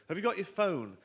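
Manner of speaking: high-pitched call after someone; wife calling after husband. Acted. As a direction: call it out from the room. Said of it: "Holly calls out".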